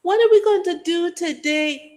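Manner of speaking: put-on high, lilting Guyanese accent; amateur Guyanese accent pitched well up. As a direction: sing the question out like a Guyanese auntie. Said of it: "'cause I'm Guyanese".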